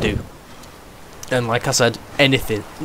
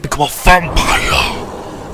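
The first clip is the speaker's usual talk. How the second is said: vampire voice; much slower than usual. Acted. Vampire transformation in gameplay.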